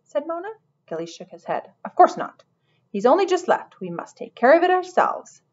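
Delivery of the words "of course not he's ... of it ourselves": high, loud dismissal; dismissive. A narrator's reading. Lizard manager refusing to message the owner, emphatically dismissive. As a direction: brush the idea off high and emphatically.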